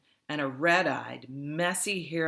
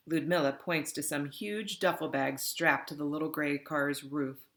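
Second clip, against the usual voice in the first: plain reading voice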